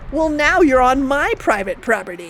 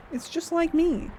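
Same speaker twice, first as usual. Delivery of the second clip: normal talking voice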